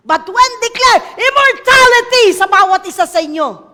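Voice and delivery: sudden high, loud preaching cry; booming declaration of death; loud proclamation. Real, not acted. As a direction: proclaim it at full force.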